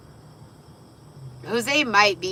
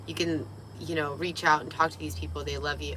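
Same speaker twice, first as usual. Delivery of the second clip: ordinary delivery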